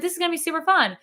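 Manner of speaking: high eager self-quote; light hammed-up glee; retold excitement.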